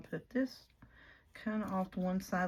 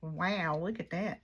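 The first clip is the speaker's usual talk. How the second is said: usual speaking voice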